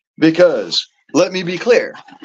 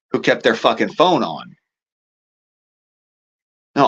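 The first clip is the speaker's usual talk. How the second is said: ordinary talk, nothing marked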